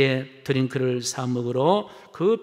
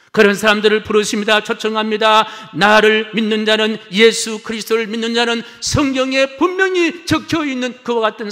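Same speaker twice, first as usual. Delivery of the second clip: high, loud pulpit proclamation; ringing, urgent sermon delivery; full-force preaching; 9 semitones higher, 12 dB louder than usual. Real, not acted. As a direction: proclaim it to the whole congregation.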